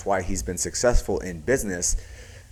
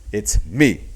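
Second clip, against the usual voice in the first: "It's me" said louder, dropping at the end; downward inflection; 7 dB louder than usual. Shown as a demonstration. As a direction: punch "It's me" and let it fall. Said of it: "So it's going down".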